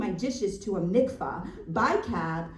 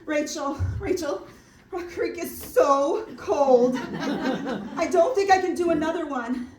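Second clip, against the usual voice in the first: high, loud cry to a friend for help; loud acted plea; 8 semitones higher, 8 dB louder than usual. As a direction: wail it out, dreading the icy water. Acts freezing plea to her friend.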